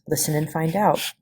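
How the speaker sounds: plain reading voice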